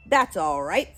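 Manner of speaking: loud, gruff bear gatekeeper; deep gruff voice. A narrator's reading. Bear within to children without money, gruff. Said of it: "said a deep, gruff voice from within".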